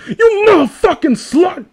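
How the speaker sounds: high, shouted aggressive dub. Retold or imitated. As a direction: yell it like a hammy dubbed thug.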